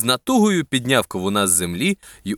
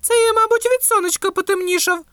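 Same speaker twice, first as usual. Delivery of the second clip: high, anxious tomato voice; worried. A narrator's reading. Tomato explaining its darker colour to watermelon, worried. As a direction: fret in a much higher pitch. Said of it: "занепокоєно промовив помідор".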